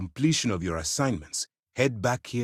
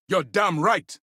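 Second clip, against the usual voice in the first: raised, high-pitched outburst; heated retort; 9 semitones higher, 4 dB louder, slower than usual. Acted. Commander in an argument, heated agreement. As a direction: fire back with force.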